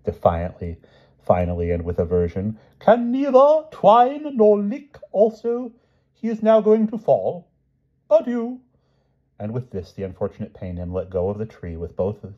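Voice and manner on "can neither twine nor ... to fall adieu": very high, slow, thin voice of a failing knight; feeble Palomides. A narrator's reading. Palomides in the beast costume, feebly high and slow. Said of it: "said Palomides feebly, defiantly".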